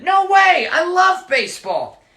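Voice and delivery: put-on high, loud outburst of a boy; indignant boy shout. Michael shouts his refusal at the leprechaun, loud and high. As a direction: blurt it out, shocked at the idea.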